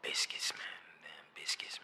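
hushed, voiceless murmur; reverent whisper. Real, not acted. Naming the food he'd miss most, reverent whisper. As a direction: whisper it like a cherished secret.

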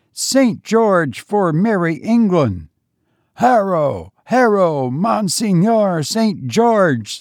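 high ringing war cries to a saint; rally a host of archers with fervent calls; a medieval army crying out to Saint George; ghostly bowmen's battle cry. A narrator's reading. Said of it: "a tumult of voices answered to his summons".

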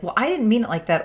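mock-defensive wife, voice lifted and hurried. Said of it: "instantly get defensive and be like".

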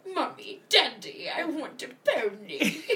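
put-on whiny child. Child begging voice.

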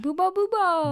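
high, loud witchy incantation; witch casting a spell. Pretend. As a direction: cast it like a cackling witch. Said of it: "like, a witch would cast".

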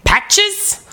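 slow, exaggerated Spanish accent; ridiculous accent. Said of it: "in this ridiculous accent".

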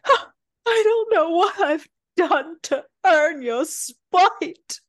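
high, slow, hammed-up wail; mock crying, loud and drawn out; fake sobbing. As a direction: sob it melodramatically to get your way. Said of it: "She starts sobbing".

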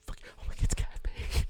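whispered fan recognition, re-enacted; mock excited whisper. Whispering to partner while watching the show, re-enacted excited whisper. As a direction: whisper it as if leaning over mid-show.

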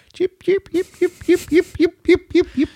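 high, yappy barking chirps; yappy chihuahua voice. Retold or imitated. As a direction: yip it out like a tiny dog. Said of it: "This is how you do chicken".

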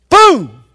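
loud, high vocal bang; explosive spoken gunfire noise; imitated gunshot. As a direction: shout a sudden gun blast. Imitates his forty-five firing.

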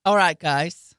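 a lightly voiced Aussie "Well, guys"; rising Australian lilt on "guys"; Australian accent. Imitating the actor's Australian greeting for a friend. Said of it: "That was Australian".

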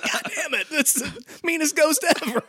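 high whiny mock kid. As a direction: whine like a wounded little boy. Nick whining at the board.

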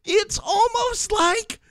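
very high, slow mock wail; mock outrage.

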